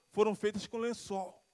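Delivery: normal talking voice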